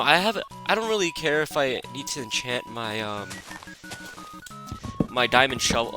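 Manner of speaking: ordinary talk, nothing marked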